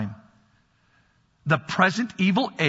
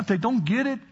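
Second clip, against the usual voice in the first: normal talking voice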